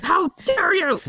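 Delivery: high indignant shout of outrage; tiny halfling furious about her hat; angry yell. Pretend. Halfling shrieks at the archer over her hat, indignant. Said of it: "getting all angry and yelling about my hat".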